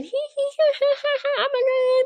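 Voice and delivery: very high, tittering mock laugh; villainess cackling. Amarantha laughing as she can't read the levers, high mock cackle. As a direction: imitate a gleeful hee-hee cackle, squealing the words through it. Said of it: "Amartya laughing".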